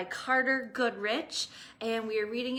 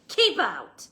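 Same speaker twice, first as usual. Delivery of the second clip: much louder grumpy bark; yell; 3 semitones higher, 9 dB louder than usual. Acted. Cactus yelling at a tortoise, grumpy. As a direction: snap at the intruder. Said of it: "yelled Hank".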